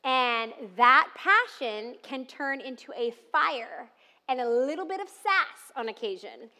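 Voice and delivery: ordinary talk, nothing marked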